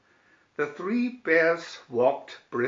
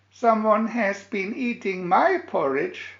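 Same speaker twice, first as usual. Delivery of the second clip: higher, louder little-cub voice; Baby Bear complaining in a wee voice; 8 semitones higher, 5 dB louder than usual. A narrator's reading. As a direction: squeak it out like a tiny bear cub. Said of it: "Baby Bear in his wee voice".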